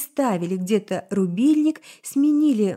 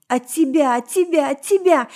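from loud to a high, louder, frantic scream; each repeat higher and louder, breaking down; building sobbing scream; 6 semitones higher, 6 dB louder than usual. A narrator's reading. Elf sobs and screams at her other self, building. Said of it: "закричала эльфийка и разрыдалась".

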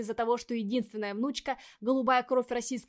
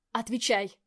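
angry demand; 6 dB louder than usual. A narrator's reading. Demands answer, shaking her.

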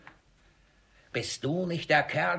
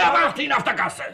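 much higher, loud and fast yell; furious shout; 10 semitones higher, 8 dB louder, faster than usual. Acted. Miser yells at the servant to wait outside. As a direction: roar the order.

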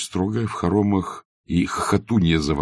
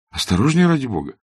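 higher and louder, pleading in alarm; frightened plea; 7 semitones higher, 4 dB louder than usual. A narrator's reading. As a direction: warn him off in sudden fright. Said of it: "с испугом крикнул ему вслед Аркадий". (испуганно, выше и громче)

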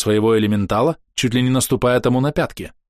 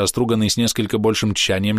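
usual speaking voice

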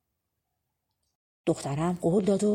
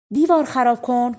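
high, loud climax of the chant; shouted call; 5 semitones higher, 6 dB louder than usual. Acted. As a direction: yell "دیوار خراب کن" louder and higher, peak of the plea. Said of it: "فریاد کشید".

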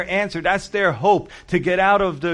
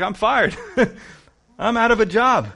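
laughing in disbelief, a bit higher; half-laughing, higher, in disbelief; rueful laugh; 5 semitones higher, 4 dB louder than usual. Retold or imitated. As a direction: laugh it off like a man just fired. Steward laughs at his firing.

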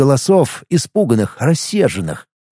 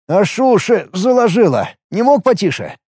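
raised-pitch, peevish complaint; grumbling; 7 semitones higher than usual. A narrator's reading. Violinist to Ит after the screaming, grumbling. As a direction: complain in a mildly put-out way. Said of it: "проворчал скрипач".

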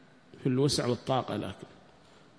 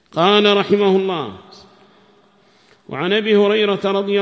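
louder, higher recitation of a text; formal hadith reading; 6 semitones higher, 8 dB louder than usual. Real, not acted. Teacher reads the hadith to students, formal raised voice. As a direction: read it out loud and high, formally.

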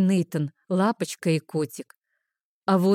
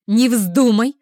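loud, raised forbidding cry; unceremonious interruption; 4 semitones higher, 7 dB louder, slower than usual. A narrator's reading. Friend cutting off Arina's plan for the dress, forbidding loudly. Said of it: "меня бесцеремонно перебили".

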